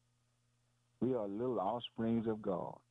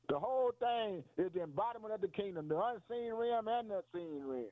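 much higher-pitched, fervent declaration; exultant preaching; 11 semitones higher than usual. Real, not acted. Preacher proclaiming all that belongs to the king, exultant. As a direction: preach it high and elated, stretching the last phrase.

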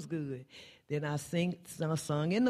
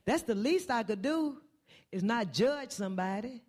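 preaching build; 5 semitones higher than usual. Acted. Preacher to congregation, building fervent preaching to a high cry. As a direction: climb from pleading to a high cry on "to minister to me".

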